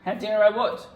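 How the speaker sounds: high, loud indignant comeback; indignant retort. Pretend. Actor retorting to partner's accusation, loud and indignant. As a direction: fire it back, offended. Improvised quarrel.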